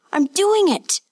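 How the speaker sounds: high, proud, thrilled shout; excited cry. A narrator's reading. Boy shouting his success to the master, thrilled. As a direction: cry out with joy at floating. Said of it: "the boy cried".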